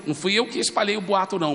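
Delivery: ordinary talk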